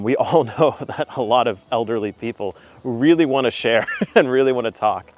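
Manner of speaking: real laughter bubbling under the line; laughing. Real, not acted. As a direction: laugh as you say it, amused by the thought. Laughing while describing chatty elders.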